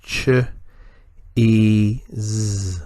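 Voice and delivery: ch, e, z with long gaps between sounds; sounded out. Shown as a demonstration. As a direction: isolate each sound: Ch, e, z. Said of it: "or the sounds".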